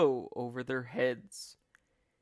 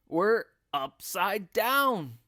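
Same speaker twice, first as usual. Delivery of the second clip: louder, slow gasping dismay; gasped; 6 semitones higher, 7 dB louder, slower than usual. A narrator's reading. Mister Twit gasping in dismay at the room. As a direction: gasp it out. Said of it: "gasped Mister Twit".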